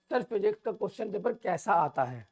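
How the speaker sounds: ordinary delivery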